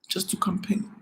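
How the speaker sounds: bitter whispered repeat, breath only. Real, not acted. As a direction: drop to a whisper on "Just to campaign".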